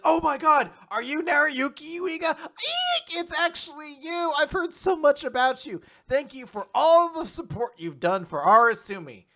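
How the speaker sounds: high, loud squealing gush; excited fangirl mom. Retold or imitated. Mom excitedly meets her daughter's classmate, high loud squeal. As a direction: gush like a starstruck fan.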